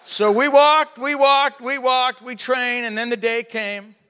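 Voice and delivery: loud, high, emphatic repetition fading down; starts loud and high, eases down; emphatic preaching. Real, not acted. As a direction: preach it out, hammering each step.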